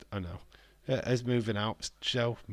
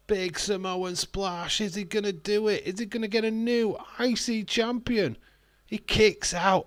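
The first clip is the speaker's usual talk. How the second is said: higher, rising wrestling call; excited commentary; 8 semitones higher, 3 dB louder than usual. Real, not acted. Streamer calling the match to chat. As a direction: call the big splash live.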